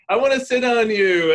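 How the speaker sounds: a hint of a small child asking; mother voicing her little boy wanting to sit on her, high and loud; lightly voiced little boy. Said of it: "He's like".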